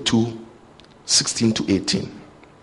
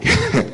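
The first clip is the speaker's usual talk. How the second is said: short laugh, pitched up high; high burst of laughter. Real, not acted. Preacher laughing to the congregation about his father. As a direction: laugh at the memory of daddy.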